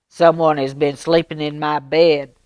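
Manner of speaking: bellow. Acted. Papa Bear finding his bed slept in, bellowing loud. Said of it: "Papa Bear bellowed".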